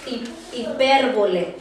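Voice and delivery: ordinary talk, nothing marked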